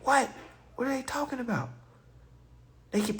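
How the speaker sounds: ordinary delivery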